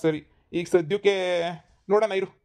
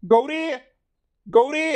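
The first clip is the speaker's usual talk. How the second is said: calling out; 7 semitones higher, much slower than usual. Acted. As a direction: call her name across the house. Calling wife from inside.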